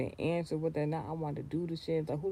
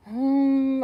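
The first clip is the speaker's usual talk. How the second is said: high loud hum; 9 semitones higher, 9 dB louder than usual. Real, not acted. Pointed hum.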